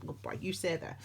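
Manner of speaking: ordinary talk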